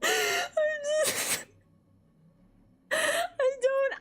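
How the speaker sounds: high, squeaky broken words; helpless laughter. Real, not acted. Storyteller unable to explain, helplessly laughing. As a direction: try to speak while cracking up. Too amused to speak.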